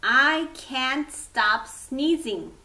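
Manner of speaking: usual speaking voice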